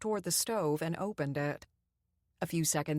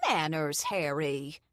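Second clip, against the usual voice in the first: mild chastising; 2 semitones lower, slower than usual. A narrator's reading. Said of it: "his wife chastised".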